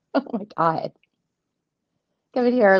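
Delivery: ordinary talk, nothing marked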